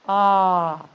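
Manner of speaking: "Ah" rounded, almost like an O; rounded British ah. Shown as a demonstration. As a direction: open "Ah" with lips rounded toward O. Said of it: "Rounded, almost like an O".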